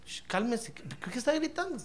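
soft and quick, a hushing voice; hushed. Retold or imitated. Calming a screaming disciple, a soft hushing voice.

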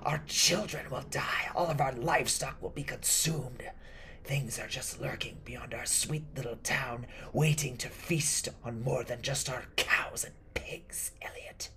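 put-on high, strained, whispery old woman's voice; ominous raspy warning. Old woman warning Elliot what the curse will do, in ominous rasp. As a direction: build the doom urgently, then drop to a whisper on the last word.

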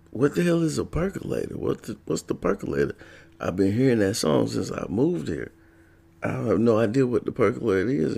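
high, puzzled question; confused newcomer asking a roommate; baffled. Retold or imitated. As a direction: sound totally lost about the word.

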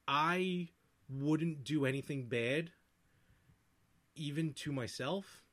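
slow, deliberate phrasing; measured innuendo; slow and careful. Real, not acted. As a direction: pick each word delicately.

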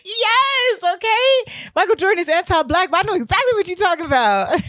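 over-the-top excited reply; delighted agreement. Retold or imitated. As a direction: squeal your agreement. Acts out her delighted agreement.

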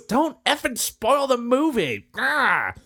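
high, slow hammed-up angry moviegoer; mock angry girl. Girl in the theater scolds him for spoilers, mock angry and high. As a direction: play her scolding him, high and dragged out.

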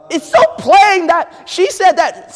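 high, loud, drawn-out protest; worked-up, high-pitched objection; exasperated shout. Acted. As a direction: wail it loudly in mock outrage. Acted inner protest, shouted.

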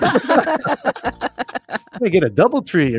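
drawn out very slowly and high, playfully entitled; mock fancy demand. Storyteller mocking the cheap motel, slow high diva drawl. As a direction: stretch it like a diva wanting a nicer hotel.

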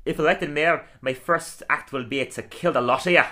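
menacing Scottish brogue; Groundskeeper Willie. Acted. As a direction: bark it like a gruff Scottish caretaker. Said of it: "Today I'm gonna do Groundskeeper Willie".

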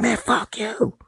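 high mock yell of an angry driver; mock road rage. Imitating a driver cursing in traffic, high mock yell. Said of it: "a dude be like".

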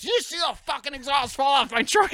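loud, high imitated shouting; retold agitated yell. Friend storming back to the car, high agitated yell. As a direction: yell it like the worked-up driver.

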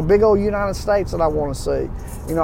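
ordinary talk

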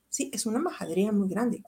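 ordinary delivery

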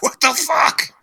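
high squeaky mock disbelief; shocked. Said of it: "we were like".

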